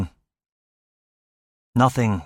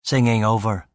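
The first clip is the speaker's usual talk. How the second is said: ordinary delivery, nothing marked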